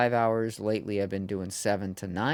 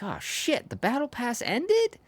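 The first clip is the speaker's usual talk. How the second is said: shocked disbelief; 12 semitones higher than usual. Retold or imitated. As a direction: squeal it in disbelief. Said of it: "I was like".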